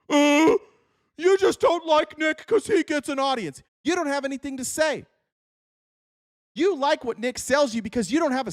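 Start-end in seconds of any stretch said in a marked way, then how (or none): 0.1-3.6 mocking a dim follower, pitched up; dumb fan voice. Mocking a Nick fan handed a mic, in a dopey put-on voice. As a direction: play a slow-witted fan whining at the mic. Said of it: "give one of you dumb motherfuckers a mic".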